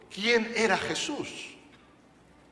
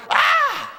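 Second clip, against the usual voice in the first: sudden high cry of fright; terrified scream; 8 dB louder than usual. Retold or imitated. Preacher imitating disciples' terrified cry. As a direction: scream like men seeing a ghost.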